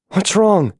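usual speaking voice

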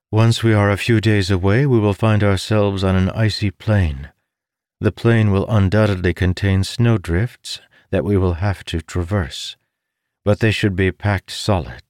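a hint of a learned woman explaining; lightly voiced scholar. A narrator's reading. Bayham describing trek.

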